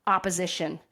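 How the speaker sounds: ordinary talk, nothing marked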